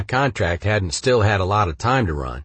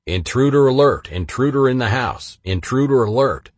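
higher, louder robotic alarm announcement; computerized alarm voice; 5 semitones higher, 4 dB louder than usual. Retold or imitated. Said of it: "alerted me with a computerized voice".

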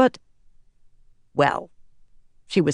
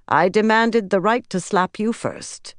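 higher-pitched, proud claim; 6 semitones higher than usual. A narrator's reading. Atheniella boasts of demanding first slap, proudly. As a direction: assert it as a point of pride. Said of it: "said proudly".

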